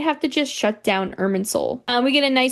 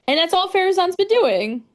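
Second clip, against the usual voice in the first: lightly snarky, pitched-up retelling; 7 semitones higher than usual. Retold or imitated. Kale complaining about Farazan to her friends, snarky. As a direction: let a little snark lift the line. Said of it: "the snark comes out".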